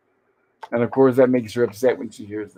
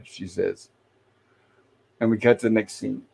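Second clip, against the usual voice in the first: ordinary delivery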